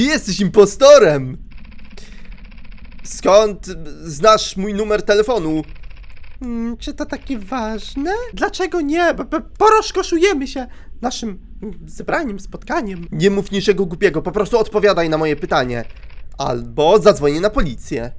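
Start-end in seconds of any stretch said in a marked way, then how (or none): 6.4-13.1 creepy caller voice. Acted. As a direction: purr it like a creepy stalker. Głos stalkerki. (wysoki, cichy, złowieszczy głos dzwoniącej)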